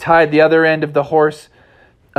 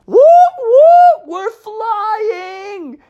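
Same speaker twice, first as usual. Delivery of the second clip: very high loud whoops, then a cry; very high thrilled cub; 4 dB louder, much slower than usual. Acted. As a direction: squeal while airborne. Junior flying through air.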